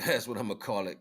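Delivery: usual speaking voice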